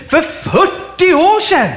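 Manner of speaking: high, drawn-out disbelieving question; incredulous echo. Acted. Preacher answering a believer's old excuse, loudly incredulous. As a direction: throw the excuse back, stretching it out slowly.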